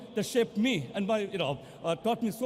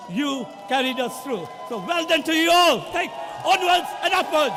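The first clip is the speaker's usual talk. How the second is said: much higher and louder, cheering the crowd; emotional shout; 8 semitones higher, 8 dB louder than usual. Real, not acted. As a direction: let the emotion burst out as a roaring thank-you. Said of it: "excuse me if I get a bit emotional".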